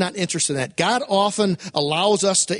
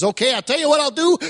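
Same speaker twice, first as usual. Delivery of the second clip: frustrated God; 7 semitones higher, faster than usual. Retold or imitated. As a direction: play God losing patience, blurting fast. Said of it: "God reaches a point of frustration".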